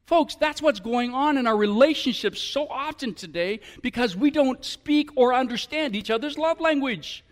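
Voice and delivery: usual speaking voice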